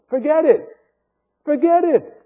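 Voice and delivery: high, sharp dismissal repeated; emphatic rebuke. Real, not acted. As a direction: shut it down firmly. Preacher rebukes demands.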